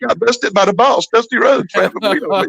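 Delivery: spiraling into alarm at getting caught; panicked inner voice. Retold or imitated. Caught by the boss with weed, climbing panic. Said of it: "I'm thinking".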